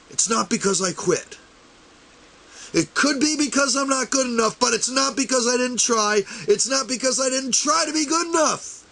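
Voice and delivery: high, impassioned, close to breaking; emotional. Real, not acted. Pleading with supporters never to quit, choked with emotion. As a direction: pour your heart into it, fighting tears. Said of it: "sorry I got a little emotional there".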